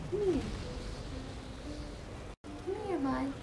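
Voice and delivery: high, soft pet-talk; coaxing a pet. Real, not acted. Owner to shy pet Marble, tender coaxing. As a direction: lure them over sweetly in a high, soft voice.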